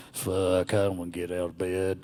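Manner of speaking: low Southern grumble; Southern accent. Acted. Grumblefuck refusing to get out of bed, Southern accent. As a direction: groan it in a weary Southern accent. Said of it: "he has a nice Southern accent".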